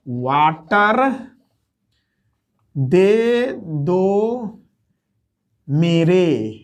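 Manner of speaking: Water, दे दो, मेरे drawn out one by one with long pauses; teacher spelling out the mnemonic to students, very slowly; slowed right down. Shown as a demonstration. Water... दे दो... मेरे, each word stretched apart. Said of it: "यानी".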